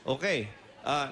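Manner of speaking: usual speaking voice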